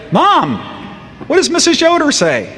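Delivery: put-on high, innocent little-boy question; innocent kid voice. Young son feigning innocence to his mother about the teacher's note. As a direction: play dumb like a ten-year-old.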